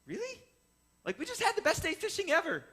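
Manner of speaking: confused disbelief. Retold or imitated. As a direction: ask like you can't believe he'd skip fishing. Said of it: "Dad and I are confused".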